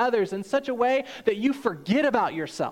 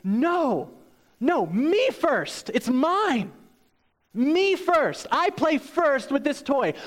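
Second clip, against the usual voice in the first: lightly voiced child, higher, insisting; selfish child; 6 semitones higher, slower than usual. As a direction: mimic a toddler claiming the toy. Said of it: "and they say".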